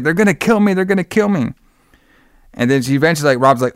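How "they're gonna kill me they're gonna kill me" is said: higher, fearful repeated plea; frightened. Retold or imitated. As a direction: plead in imitated terror. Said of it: "She's, like, frightened".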